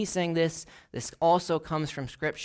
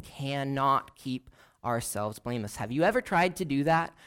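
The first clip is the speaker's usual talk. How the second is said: ordinary talk, nothing marked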